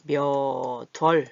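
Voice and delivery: 몇 월 drawn out slowly as 며-덜; slow syllable-by-syllable liaison, 몇 월 as myeo-deol; linked pronunciation. Shown as a demonstration. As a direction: stretch 몇 월, carrying the ㄷ into 월. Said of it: "연음이 돼야 되잖아요".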